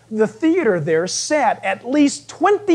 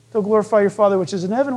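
ordinary talk, nothing marked

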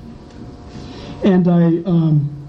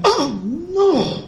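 mock panic; 9 semitones higher than usual. Realizing he broke law.

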